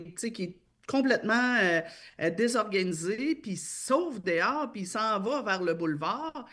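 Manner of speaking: ordinary talk, nothing marked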